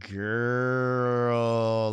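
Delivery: put-on "Girl" stretched long and pulled out; drawn-out girl. Shown as a demonstration. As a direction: pull the vowel of "Girl" way out. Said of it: "like, pull it out".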